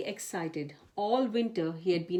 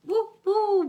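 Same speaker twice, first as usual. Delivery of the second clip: high, loud wind howl; 14 semitones higher, 7 dB louder than usual. A narrator's reading. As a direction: moan it like the wind in the trees. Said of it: "blew the wind in the trees".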